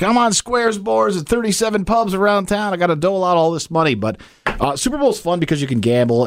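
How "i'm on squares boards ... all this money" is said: higher-pitched mock bar-hopping relative; imitating aunts and uncles. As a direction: quote a squares gambler, pitched up. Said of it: "They're like".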